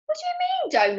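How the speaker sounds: high start, dropping to an everyday pitch; indignant granny. A narrator's reading. Granny throws Ben's order back at him, indignant. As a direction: query the order, put out.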